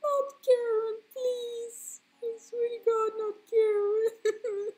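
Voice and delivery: very high, loud squealing wail; anguished mock protest. Streamer refusing the nickname Karen, mock anguished shriek. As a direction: shriek it in hammed-up despair, stretching the end.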